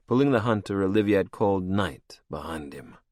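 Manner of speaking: ordinary delivery, nothing marked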